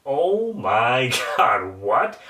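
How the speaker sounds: slow, stretched disbelief broken by a laugh; retold stunned laugh at a gory scene; disbelieving laugh. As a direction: laugh through the shock. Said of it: "And I was just like".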